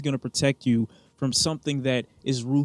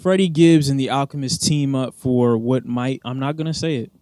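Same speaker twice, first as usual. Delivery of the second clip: ordinary delivery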